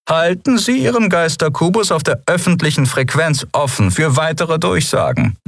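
high formal voice; official advice. A narrator's reading. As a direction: advise as the peacekeeper. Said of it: "riet der Yadi". (hohe, förmliche Stimme des Yadi)

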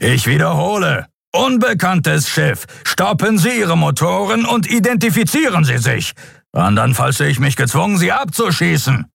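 high-pitched, forceful captain's warning; Sron, repeating order. A narrator's reading. Captain threatening to fire on the ship, commanding. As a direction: repeat the order with strained authority. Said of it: "dröhnte es aus dem Kubus".

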